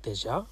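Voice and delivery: déjà with a rising question tune; questioning intonation. Retold or imitated. Checking with the French teacher how she meant it, questioning. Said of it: "was it like a déjà?".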